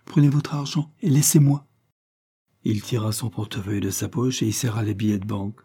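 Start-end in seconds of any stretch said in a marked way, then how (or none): 0.0-1.7 firm, higher dismissal; dignified. A narrator's reading. Isabelle dismisses Levison and his money, dignified. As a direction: send him off with dignity. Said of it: "en se redressant avec dignité".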